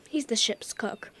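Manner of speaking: quick whispered aside. Acted.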